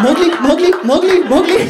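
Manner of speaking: high, loud sing-song calling; calling the dog. Retold or imitated. Chasing his dog round the room, high laughing call. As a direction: coax the dog over, laughing.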